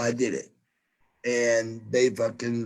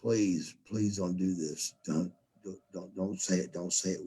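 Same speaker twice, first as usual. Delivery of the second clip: soft, low, hushed begging; silent pleading; 6 semitones lower, 9 dB quieter, faster than usual. Retold or imitated. Wrestlers silently begging their friend not to confess, hushed and low. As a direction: mouth a quiet plea not to say it. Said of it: "all the guys were looking at me like".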